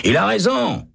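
high shout from a cell. A narrator's reading. Prisoner Hennessy shouts from his cell, high. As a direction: call it out high down the corridor. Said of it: "crie Hennessy".

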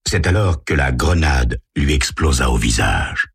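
ordinary delivery, nothing marked

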